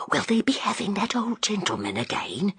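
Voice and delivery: pleading whisper. A narrator's reading. Frightened landlady asking the inspector, in a whisper. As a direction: whisper it, anxious and begging. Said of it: "She spoke in a whisper".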